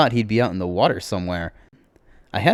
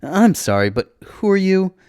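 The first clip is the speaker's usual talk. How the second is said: high, louder incredulous question; baffled; 11 semitones higher, 7 dB louder than usual. Acted. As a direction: drag out the question, voice climbing high. Baffled hero questions the cheerful stranger.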